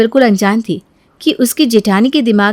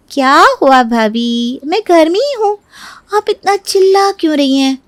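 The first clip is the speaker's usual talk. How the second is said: feigned innocence; 6 semitones higher than usual. Acted. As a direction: answer the yelling with an innocent complaint. Unbothered reply to the yelling. (अनजान बनकर, खींचकर ऊँची आवाज़ में)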